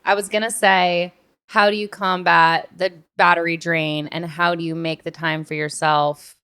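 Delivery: usual speaking voice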